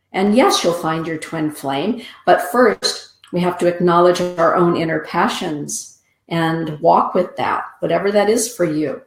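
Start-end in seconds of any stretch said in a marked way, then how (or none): none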